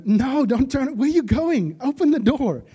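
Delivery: high, loud pretend scream at Rhoda; mock scream. Audience screaming at Rhoda on screen, high loud yell. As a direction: scream at her to open the door. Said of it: "we would probably be screaming at her".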